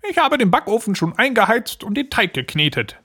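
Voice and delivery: high-pitched witch voice; scheming old witch. Acted. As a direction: explain slyly in a high witch voice. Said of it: "sagte die Alte". (listige alte Hexe)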